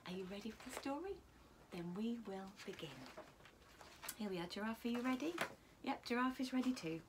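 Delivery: plain reading voice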